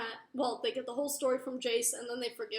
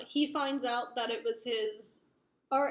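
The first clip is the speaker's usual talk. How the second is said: usual speaking voice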